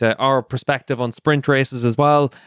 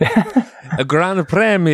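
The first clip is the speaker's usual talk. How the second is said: mock Italian accent; 7 semitones higher, much slower than usual. Shown as a demonstration. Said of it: "Don't forget the accent".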